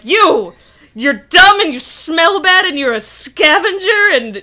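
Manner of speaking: mock insulting rant. Kylo insulting her in his confession, mock rant. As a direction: hurl the insults in play-acted indignation.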